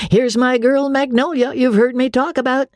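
high, jovial riverboat captain; hearty captain voice. Acted. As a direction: introduce them with bluff cheer. Andy voiced.

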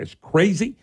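ordinary delivery

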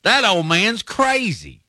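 high, drawn-out exclamation; exclaimed quote. Retold or imitated. Host quoting Terry Henley on Coach Bryant, exclaiming. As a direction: stretch it out high, quoting someone's blunt verdict.

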